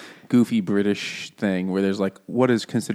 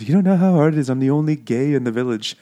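mock Welsh lament; 5 semitones higher, 3 dB louder, faster than usual. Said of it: "where the one guy is like".

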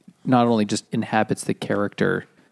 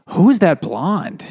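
imitated intrigued audience; 5 semitones higher, slower than usual. Moviegoers noticing the new actress, imitated intrigue. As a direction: act out a struck moviegoer, drawn out.

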